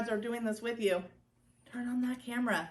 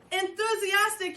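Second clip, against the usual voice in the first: loud, raised, peppy call; called-out demonstration; 7 semitones higher, 6 dB louder than usual. Shown as a demonstration. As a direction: belt out the word with pep. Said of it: "We're gonna say".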